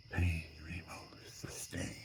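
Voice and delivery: hushed, slow, ghostly whisper; whispered inner voice. Retold or imitated. The voice in the background whispering to him, slow and eerie. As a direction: breathe it like a voice in your head. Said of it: "The voice in the background says".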